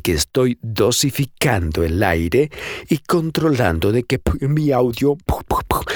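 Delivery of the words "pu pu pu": breathy unvoiced p pops into the mic; showing how raw air pops the mic; popping plosives. Shown as a demonstration. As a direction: puff hard p sounds at the microphone. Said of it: "no salga así a lo bruto".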